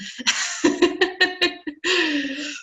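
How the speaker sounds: real high chuckle in quick bursts; self-deprecating laugh. Real, not acted. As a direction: laugh off how awful you sound. Laughing at own awful voice.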